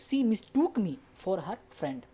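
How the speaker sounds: very slow careful English examples; slow model sentences. Shown as a demonstration. Teacher modelling the mistook variant slowly. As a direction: lay each word out slowly. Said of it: "इस तरह से बोल सकते हो".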